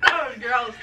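ordinary talk

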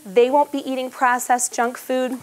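usual speaking voice

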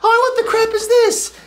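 high, incredulous cry; mock outrage. Man hit by condoms at parade, mock outrage. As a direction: exclaim in high-pitched disbelief. Acted shocked reaction in story.

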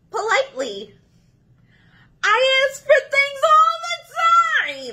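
loud, whiny pigeon pleading its case; a needy bird bursting with complaints; excitable pushy pigeon. Acted. Pigeon pestering the Duckling about the cookie, whiny and loud.

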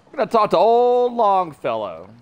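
put-on loud Mayor Quimby accent with a Boston twang. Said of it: "came out in my Mayor Quimby accent".

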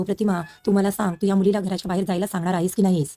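usual speaking voice

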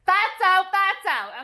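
loud, high, punchy repeats; high, exclaimed judgment; emphatic exclamation. Real, not acted. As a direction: call it out in loud disapproval. Exclaiming over fattening drink.

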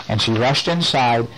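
ordinary delivery, nothing marked

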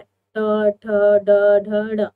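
each retroflex letter spoken out clearly; retroflex sounds. Shown as a demonstration. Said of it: "जहां पर आपकी जीभ पलट जाए".

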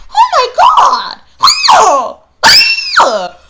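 very high, slow shocked shout; imitating the shocked crowd. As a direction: gasp out like horrified onlookers. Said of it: "everybody's like".

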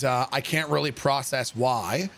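ordinary delivery

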